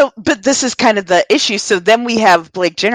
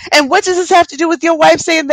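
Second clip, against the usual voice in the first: incredulous question; 10 semitones higher, faster than usual. Retold or imitated. She keeps confronting Ryan.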